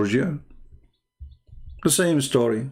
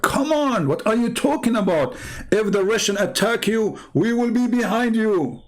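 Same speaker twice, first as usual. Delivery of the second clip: high, hammed-up reassuring promise; mock the big promise with a pitched-up voice; mocking Bush; 9 semitones higher than usual. Bush assuring Georgia's president, hammed-up and pitched high. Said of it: "he promised the president, like".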